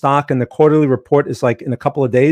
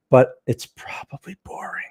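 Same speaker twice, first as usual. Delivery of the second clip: whisper; 5 dB quieter than usual. Pretend. Joking aside about central bankers.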